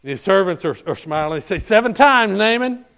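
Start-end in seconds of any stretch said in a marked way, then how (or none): 1.6-2.8 high, loud encouraging call. Acted. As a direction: cheer him on from the bank. Servants calling out encouragement.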